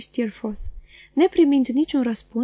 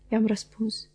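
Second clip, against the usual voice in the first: ordinary delivery, nothing marked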